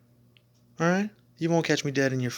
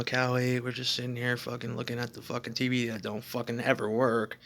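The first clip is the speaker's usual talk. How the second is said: ordinary talk, nothing marked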